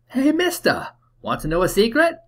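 put-on high, excited Cockney street kid; Cockney accent. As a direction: play a cheeky Cockney urchin. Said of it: "doing my best Cockney accent".

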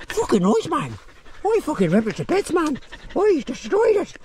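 high silly bragging dog voice; voicing the dog. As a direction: boast like the dog who won the fight. Voicing dog bragging about kill.

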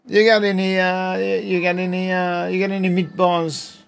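high, hammy Italian plumber voice; over-the-top cartoon Italian; Mario voice. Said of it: "You gotta talk like Mario".

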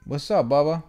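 high, bright sing-song greeting to the cat; pet talk. Real, not acted. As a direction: coo at the cat.

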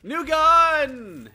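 loud, high, drawn-out cheer; excited call-out. Real, not acted. Player announces a weapon find to partner, loud excited call. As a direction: sing out the find with glee.

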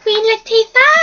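high, loud excited call; excited shout. Real, not acted. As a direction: shout the name out gleefully. Calls out the name.

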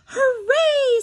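high, sung-out cheer after a gasp; joyful hooray. Acted. Froggy hearing they're going out to eat, high happy cheer. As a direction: cheer like a delighted kid. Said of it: "sang Froggy".

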